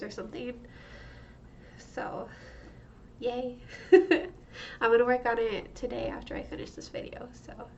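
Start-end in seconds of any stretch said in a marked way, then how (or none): 3.6-4.3 bright little laugh; high laugh. Real, not acted. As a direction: laugh briefly. Laughs after yay.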